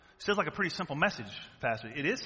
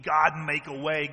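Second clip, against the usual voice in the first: earnest prayer; 4 dB louder, slower than usual. Retold or imitated. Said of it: "repeat builds".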